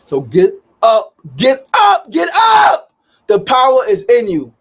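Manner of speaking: building to a loud, high motivational shout; rallying shout. Real, not acted. Host rallying viewers to get up, building to a shout. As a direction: pump them up, rising to a yell.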